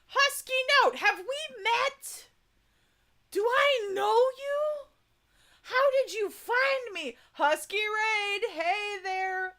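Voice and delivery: high, squealing raid greeting; excited squeal. Real, not acted. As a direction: shriek with delight at the raid. Excited greeting of incoming raiders.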